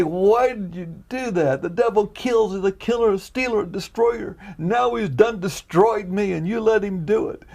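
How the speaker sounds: high, loud anguished protest to God; aching complaint. Retold or imitated. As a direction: plead like someone badly wronged. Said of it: "complaining, aching".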